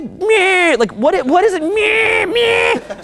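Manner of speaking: high, whining "Meh" sound; mock a bored complainer; whiny meh. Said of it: "You're like".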